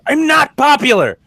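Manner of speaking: put-on high, loud, drawn-out kid protest; mock-outraged kid yell; exasperated daughter. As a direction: wail it like a teased child. Dad voices his exasperated daughter.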